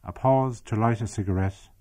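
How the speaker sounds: measured storytelling read; story narration. A narrator's reading. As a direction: tell the village tale evenly, like a documentary. Documentary narration of memoir.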